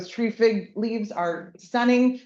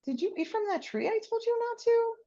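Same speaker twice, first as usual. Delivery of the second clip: higher, softer gentle asking; soft gentle question; 13 semitones higher, 4 dB quieter, faster than usual. Retold or imitated. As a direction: ask kindly, no blame. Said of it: "such a soft tone".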